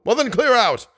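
high retold bark; Remy retelling the manager's shout, high and sharp. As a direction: fling him out of the office. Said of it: "he shouted at me".

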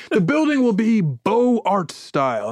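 hammed-up grandeur; lofty con man. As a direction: savor it like a snob. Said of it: "he's like".

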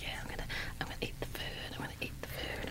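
fast hushed whisper. Retold or imitated. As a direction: whisper it quickly to yourself. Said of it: "I'm like".

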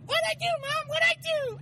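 mock bratty boy shrieking at his mom; whiny kid shout. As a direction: yelp it like a kid getting a spanking. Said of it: "He's like".

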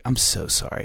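hushed apology. Retold or imitated. Apology to friend retold.

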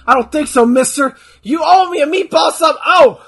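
loud, high, furious ranting; angry shout. Pretend. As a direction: yell it like a wrestler cutting a promo. Voicing a wrestler yelling at Vince.

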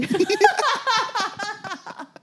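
loud laughing fall; real laugh; high burst of laughter. Real, not acted. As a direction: crack up at the punchline. After story punchline.

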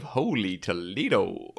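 slow, drawn-out mock American accent; a hint of a put-on American accent; quoting a fake American accent. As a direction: savor the catchphrase in a fake American drawl. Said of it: "It is a terrible accent".

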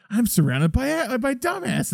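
high-pitched imitation of fed-up disbelief; mock exasperation. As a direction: voice a boss despairing of useless help. Voicing boss fed up with henchmen.

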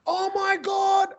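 high, drawn-out mock gasp of horror; shocked realisation. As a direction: imitate her dawning horror, stretched out slowly. Acting out her shocked realisation.

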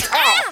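high, loud hammed-up yelp; mock cry of pain. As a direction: yelp as if an arrow just struck. King hit by an arrow.

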